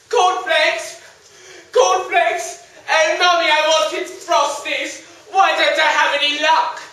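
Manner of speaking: high, loud whining complaint; whiny child voice. Acted. Young man complains to mother.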